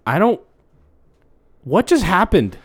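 high, loud confusion; bewildered. Acted. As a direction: come to with a startled outburst. Acted coming to after blackout.

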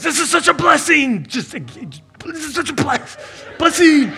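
high-pitched, hammed-up gratitude; overjoyed outburst. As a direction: imitate someone bursting with thanks in a high voice. Acting Michael's reaction to free shoes.

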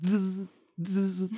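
soft zz buzzing sound; buzzing noise. Retold or imitated. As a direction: imitate an insect's buzz by the ear. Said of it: "buzzing sound".

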